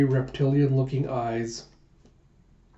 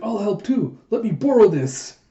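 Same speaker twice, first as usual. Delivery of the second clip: high, bright-eyed offer, louder at the end; eager volunteering; 7 semitones higher, 5 dB louder than usual. A narrator's reading. Yona offers to help the others dig, eager. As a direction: play a keen girl jumping in to help.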